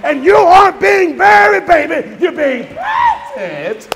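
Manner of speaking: shouted, high preaching peak; preaching climax. Real, not acted. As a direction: whoop it to the congregation. Preacher at full force.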